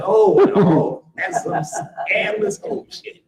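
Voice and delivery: high, loud mock-scandalized cry; scandalized shriek. Reacting to grandma's kissing story, high mock-scandalized squeal.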